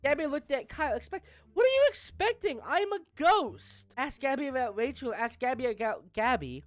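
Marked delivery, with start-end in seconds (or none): 1.5-3.9 mock indignant. Ghost Kyle indignantly answering his neighbor Gabby. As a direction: act offended that she's surprised.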